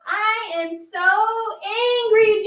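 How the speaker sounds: put-on high, slow, half-sung angry kid voice; angry child. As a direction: stretch it out like a sulking child singing. Voicing Amy again.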